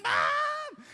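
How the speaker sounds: very high crying wail; crying call for mom. Retold or imitated. Hurt little boy wailing for mom, very high. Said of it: "I come out and I'm crying".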